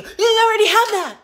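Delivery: high, loud mocking nag. Imitating people who nag her about wants, loud and high. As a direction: throw the reminder back in a shrill mock voice.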